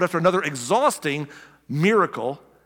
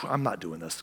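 weary; 9 semitones lower, 8 dB quieter than usual. Retold or imitated. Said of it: "He was spent".

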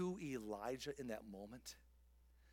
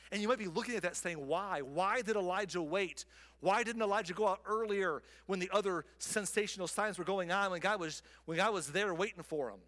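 raised, insistent questions; raised challenging voice; louder questioning; 5 semitones higher, 7 dB louder than usual. Pretend. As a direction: push the questions out loudly. Preacher voices a listener's objection.

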